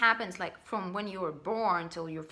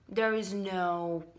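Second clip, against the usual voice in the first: ordinary delivery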